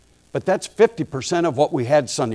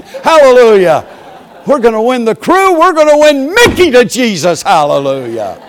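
much higher and louder, shouting praise; jubilant preaching; 7 semitones higher, 8 dB louder than usual. Retold or imitated. Preacher announcing his Disney mission to the crowd, jubilant shout. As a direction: whoop it up like a revival.

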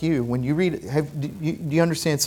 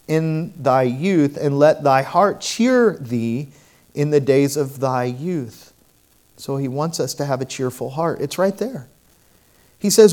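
fervent pulpit preaching; preaching at full force; 6 dB louder than usual. Acted. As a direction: drive the sermon home to the congregation.

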